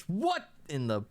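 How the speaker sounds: high, slow, shocked exclamation; startled outburst. Acted. Scholar dropping the freezing stone, high startled outcry. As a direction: jolt back in alarm. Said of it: "drops it and goes".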